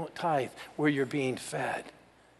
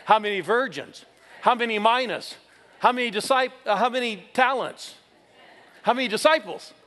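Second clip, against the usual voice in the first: higher and louder with each question, building; preacher quizzing the congregation, building in energy; rapid-fire quiz; 6 semitones higher, 6 dB louder than usual. Real, not acted. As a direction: fire questions at the crowd, climbing. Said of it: "Now let's go real quick".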